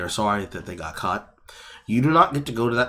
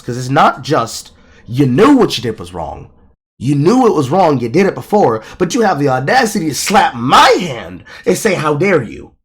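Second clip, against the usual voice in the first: rising, louder angry rant; getting louder and angrier at the hypocrisy; heated rant; 4 semitones higher, 7 dB louder than usual. Real, not acted. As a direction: build it up into an outraged tirade. Ranting about hypocrisy, voice rising.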